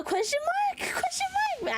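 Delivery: squeaky, very high indignant question; hammed-up squeaky complaint; high mock whine. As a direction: whine it up like a cartoon. Mock whining at chat.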